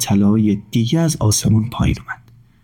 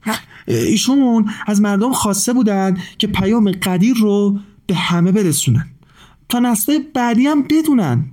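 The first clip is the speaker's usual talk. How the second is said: high, chirpy bird voice; a small bird explaining its quest; cheerful high bird-character voice; lively little bird; 7 semitones higher than usual. A narrator's reading.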